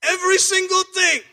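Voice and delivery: "Every single thing" shouted high and loud; loud proclamation. Real, not acted. Preacher to congregation, about God's gifts, in a loud high proclamation. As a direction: proclaim it at the top of your voice.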